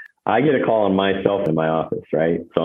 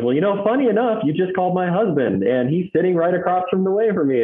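imitating wife; 11 semitones higher than usual. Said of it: "And she goes".

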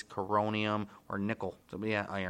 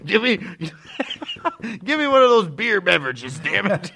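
high, louder laughing demand; silly saloon customer; 8 semitones higher, 6 dB louder than usual. Acted. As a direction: bark the order like a cartoon cowboy. High loud mock demand.

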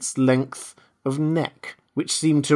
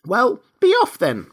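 high, loud sulky dismissal; 11 semitones higher, 5 dB louder than usual. A narrator's reading. Pigeon gives up and dismisses Alice, sulky. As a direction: send them off in a huff. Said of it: "in a sulky".

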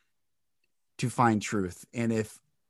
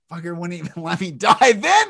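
laughing mock fury; 7 semitones higher, 11 dB louder than usual. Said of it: "I'm like".

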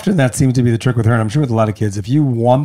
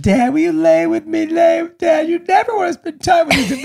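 whiny daughter. Retold or imitated. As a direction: mimic a sulky little girl begging dad. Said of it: "The other night she said".

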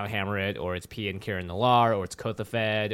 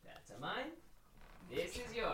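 talking to a dog; 7 semitones higher, 10 dB quieter than usual. Real, not acted.

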